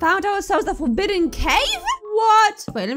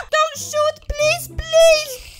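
panicked surrender shout; 10 semitones higher, 6 dB louder, much slower than usual. Pretend. Begging mob not to shoot.

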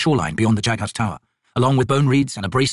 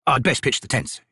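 plain reading voice

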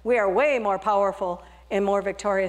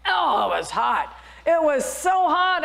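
high, slow, louder complaint; in the heat; 6 semitones higher, 3 dB louder than usual. Acted. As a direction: feel the heat on every word. Teacup in the oven.

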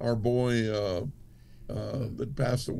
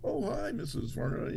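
put-on quoting the girls; 6 semitones higher, 7 dB quieter than usual. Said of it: "the girls are all".

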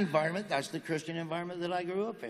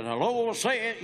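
lightly voiced backwoods Southern preacher; a hint of a preaching dad; 6 semitones higher, 3 dB louder than usual. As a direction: mimic a country preacher's exclamation. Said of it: "my dad would get to preaching".

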